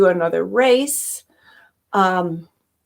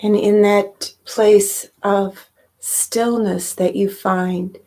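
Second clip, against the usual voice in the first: guided meditation. Real, not acted. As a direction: gently lead listeners inward, leaving space between phrases.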